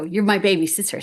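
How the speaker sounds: normal talking voice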